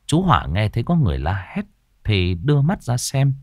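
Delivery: ordinary delivery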